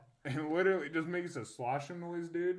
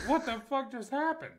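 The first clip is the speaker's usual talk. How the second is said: dazed disbelief; 13 semitones higher, 5 dB louder than usual. Retold or imitated. His dazed reaction retold.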